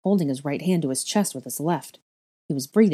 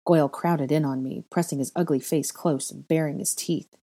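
plain reading voice

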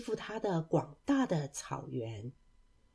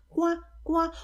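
high, spoken duck quacks; duck quacking; 9 semitones higher than usual. Acted. Mother duck calling her ducklings, quacking.

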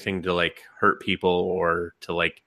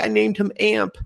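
high-pitched, cutesy dad voice; smitten dad; 13 semitones higher, 3 dB louder than usual. Retold or imitated. Dad tells mom about the cat, high smitten voice. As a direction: go high, pleading to keep it. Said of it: "And he's like".

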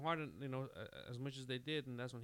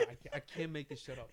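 ordinary delivery

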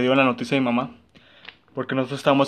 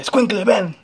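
uncle calling; 7 semitones higher, 3 dB louder, slower than usual. Retold or imitated. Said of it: "me decía".

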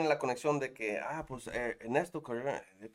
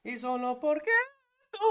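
voice cracking; 12 semitones higher than usual. Retold or imitated. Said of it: "me salió un gallo machín".

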